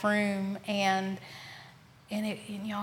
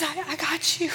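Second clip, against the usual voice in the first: tender reassurance; 7 semitones higher than usual. Retold or imitated. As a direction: hold her gently with the words. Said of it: "He says".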